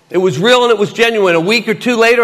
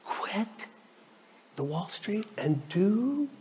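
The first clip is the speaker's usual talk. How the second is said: very soft, halting, rising at the end; timid hushed question; 3 semitones higher, 16 dB quieter, much slower than usual. Retold or imitated. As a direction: barely get the question out. Acts out timid question to God.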